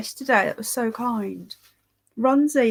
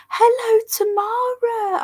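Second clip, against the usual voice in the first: excited greeting; 13 semitones higher, 4 dB louder, slower than usual. Real, not acted. Greeting a friend in chat.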